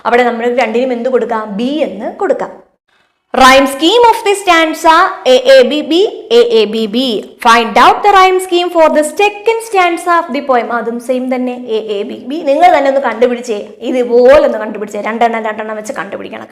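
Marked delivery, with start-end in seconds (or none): 3.3-10.7 reading the question out very slowly; slow, deliberate classroom read-aloud; slow textbook reading. Acted. Teacher reads textbook instructions.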